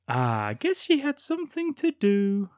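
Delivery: put-on high, robotic boy's voice; malfunctioning android. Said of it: "like some sort of malfunctioning android".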